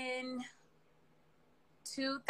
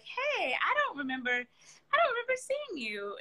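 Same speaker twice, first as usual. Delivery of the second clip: high, surprised voice; 9 semitones higher than usual. Retold or imitated. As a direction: pitch jumping up in surprise. People questioning if she's local.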